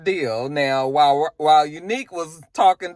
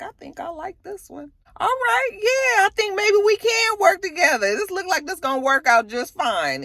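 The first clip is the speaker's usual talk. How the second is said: much higher, lightly put-on voice for the man; approving man; 14 semitones higher than usual. Man tells Unique they can work together, high pleased voice. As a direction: sound pleased and sold on him.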